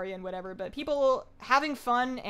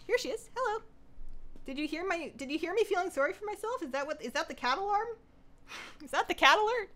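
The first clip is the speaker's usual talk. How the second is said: much higher pet-talk voice; talking to the cat; 8 semitones higher than usual. Real, not acted. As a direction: coo at the cat as she walks in. Cat appears on stream.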